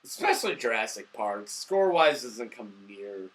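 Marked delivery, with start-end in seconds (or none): none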